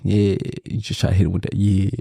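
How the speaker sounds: sexy deep voice. Shown as a demonstration. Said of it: "put your sexy voice on".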